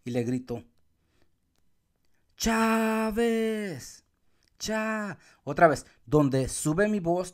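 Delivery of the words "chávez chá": high, long-held "Chávez", stretched on Chá; calling a friend. Shown as a demonstration. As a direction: hail Chávez across a distance. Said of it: "le voy a gritar a mi amigo".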